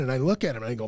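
usual speaking voice

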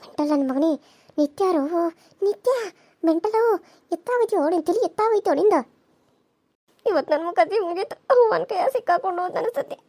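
soft, frantic aside; wife scolding husband under her breath; hushed whisper. Acted.

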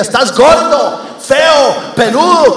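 a brute belittles his partner, hammed-up loud; mock insulting yell. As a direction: spit the insults at the boyfriend. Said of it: "le decía".

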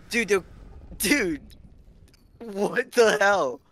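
slow, drawn-out shocked question; stunned disbelief. Real, not acted. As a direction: stretch it out in disbelief. Stunned by game event.

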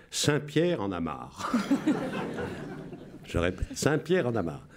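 giggling through the coded line; amused announcer breaking up; laughing. Real, not acted. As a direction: laugh as you read it.

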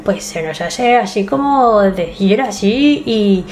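slow, lightly put-on Spanish accent from Spain; a half-joking Mexican take on a Spaniard's accent; a hint of a Spanish accent, drawn out; imitating a Spaniard. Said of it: "cuando yo imitaba un español".

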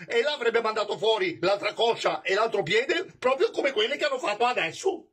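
high, nervous but clever retort; quick-witted servant. Acted.

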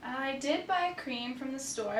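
ordinary delivery

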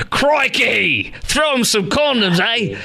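high-pitched mock Australian accent; hammed-up Aussie accent, cried out high; bad Australian accent. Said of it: "my horrible Australian accent".

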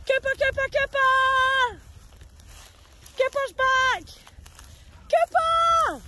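high, loud delighted calls; excited greeting. Real, not acted. Dog walker thrilled to see Cooper, calling loudly. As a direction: greet a favourite dog who's back.

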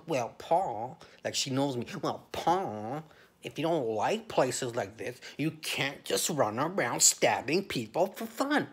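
slow, lecturing mock voice of the agent; condescending agent woman. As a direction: talk down to him like a prim official. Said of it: "And she's like".

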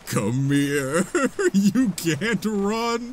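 slow, drawn-out laughing taunt; laughing villain taunt. Acted. Taunting the fleeing enemy, laughing villain voice.

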